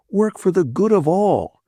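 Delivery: lightly hammed-up lofty slogan; mock solemn Bill. A narrator's reading.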